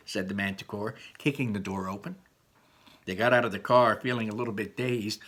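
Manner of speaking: plain reading voice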